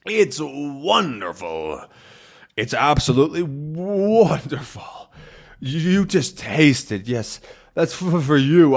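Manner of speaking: slow, savoring, high and delighted. Acted. Haddock tasting the old rum, slow delighted savoring. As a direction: relish every word.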